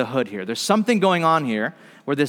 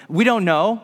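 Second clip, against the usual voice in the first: usual speaking voice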